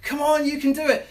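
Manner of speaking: put-on high, bright-pushed cheer to a flagging boy; encouraging. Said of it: "and, like".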